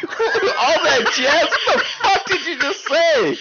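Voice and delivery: high, laughing what-the-hell; real incredulous laughter through the words; laughing disbelief. Real, not acted. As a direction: crack up in disbelief, pitch way up. Incredulous at cohost's blunder.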